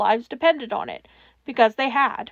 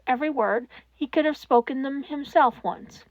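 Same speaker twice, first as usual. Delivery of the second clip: plain reading voice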